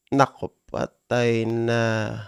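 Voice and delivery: ordinary talk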